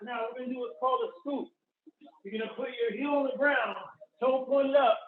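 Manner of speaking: usual speaking voice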